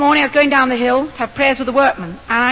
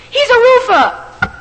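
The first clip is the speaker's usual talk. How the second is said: high, slow, emphatic burst of news; thrilled workman revealing the answer; excited announcement; 11 semitones higher, 6 dB louder, much slower than usual. Retold or imitated. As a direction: blurt out the good news.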